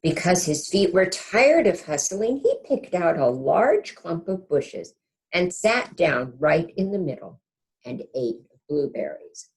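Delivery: ordinary delivery, nothing marked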